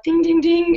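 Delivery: high, sing-song ding ding ding; alarm bell ding. Retold or imitated. Mother marking alarm bells over her son's words, high sing-song ding.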